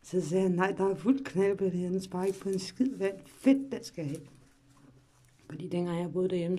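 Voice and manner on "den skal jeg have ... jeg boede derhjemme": ordinary talk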